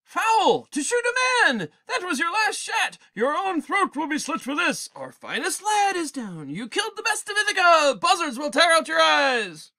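high, loud, furious crowd shouting; shrieking accusations at Odysseus; suitors yelling. Acted. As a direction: hurl it at him like an outraged mob. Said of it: "these are the suitors yelling at Odysseus".

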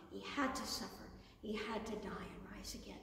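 low, whispered and solemn; hushed whisper. Acted. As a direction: drop to a whisper. Solemn telling of the passion.

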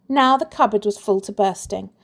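plain reading voice

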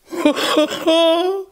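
very high, scornful hare laugh; mocking laugh. Hare laughs at the idea of losing the race, high and scornful. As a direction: laugh him off as absurd.

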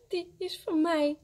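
put-on high, mildly tearful little-boy voice; sobbing. A narrator's reading. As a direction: claim it through acted sobs. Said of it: "snikt Willem".

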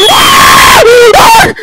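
loud high shriek of fright; scream. Real, not acted. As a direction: shriek at the jump scare. Jump scare.

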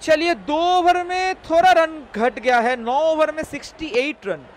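high, loud score announcement; live play-by-play. Acted. Streamer sums up the over for viewers, loud commentary voice. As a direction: project the over summary like a commentator.

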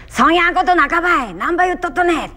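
high, loud, indignant Kumamoto dialect line. Shown as a demonstration. Actor demonstrating her debut-role line, lively Kumamoto dialect. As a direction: blurt it out in full Kumamoto dialect. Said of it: "私の火の国には熊本弁です".

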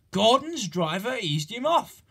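usual speaking voice

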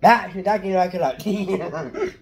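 loud, high, laughing call; laughing Grinch shout. Pretend. Kid yelling at Max in Grinch voice, laughing.

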